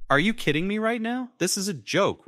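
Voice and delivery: high, loud disbelief; high-pitched stunned questioning; incredulous. A narrator's reading. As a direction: react in disbelief, voice shooting up. Son stunned by father's confession.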